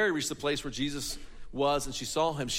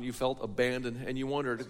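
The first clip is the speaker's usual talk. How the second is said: usual speaking voice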